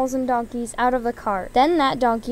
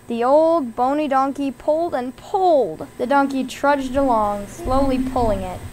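plain reading voice

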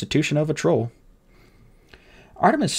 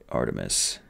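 much lower, softer, fading strength; a strong man's last weak words; comforting the boy while dying; dying bodyguard; 8 dB quieter than usual. Acted.

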